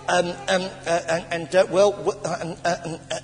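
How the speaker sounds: stuck on fillers, words won't come; imitated stuttering. Preacher showing how he couldn't get words out at dinner, imitated stutter. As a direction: stammer helplessly between false starts. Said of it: "I'm stuttering over the word".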